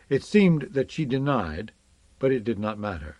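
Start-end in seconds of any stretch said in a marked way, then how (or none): none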